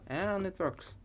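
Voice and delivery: pleased, pitch jumping up; happy it finally works; excited. Real, not acted. Program finally runs correctly.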